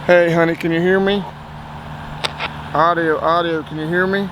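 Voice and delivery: ordinary talk, nothing marked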